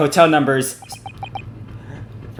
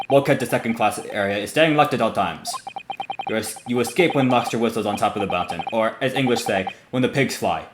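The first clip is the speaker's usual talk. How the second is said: lightly voiced Russian guard, mocking; a hint of a Russian sailor with a proverb; light Russian accent; 2 semitones lower than usual. Guard telling stowaways they will never escape, light Russian accent. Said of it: "I might be trying to do their accent".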